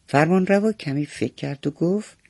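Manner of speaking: usual speaking voice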